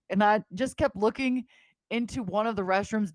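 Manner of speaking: usual speaking voice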